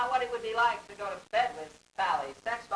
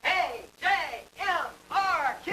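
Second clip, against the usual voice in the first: jumpy letters, pitch climbing; letters that jump ahead; leaping letters; 7 semitones higher than usual. Shown as a demonstration. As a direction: skip through them in big leaps. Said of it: "He goes in big leaps".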